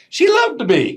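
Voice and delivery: sudden high squeal of disbelief; high, loud squeak of mock surprise; incredulous shriek. Real, not acted. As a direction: yelp it like you can't believe it. Self-mocking exclaim at own misreading.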